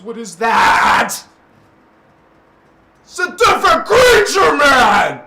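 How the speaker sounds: real emotional cry at the audience; emotional outburst. Real, not acted. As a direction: shout it out, near tears. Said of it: "Sorry I got so emotional".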